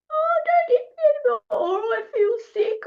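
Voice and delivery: high, whiny mock wail; poor-me victim. Acting out a poor me to beat an intimidator, mock wailing. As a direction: play up the helpless victim. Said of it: "if you're a poor me".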